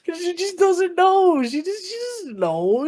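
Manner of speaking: giggling through the words; high and laughing, can't stop. Real, not acted. Laughing while describing.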